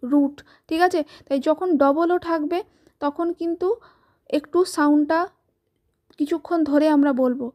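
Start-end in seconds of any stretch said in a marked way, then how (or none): none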